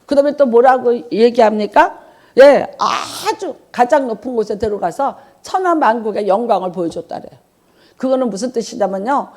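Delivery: ordinary talk, nothing marked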